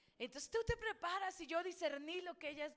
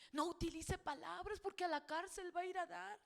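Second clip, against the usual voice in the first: usual speaking voice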